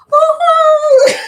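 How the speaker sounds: very high loud whoop; very high loud woo-hoo of delight; excited cheer. Real, not acted. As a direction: shriek with joy. Celebrating bot working.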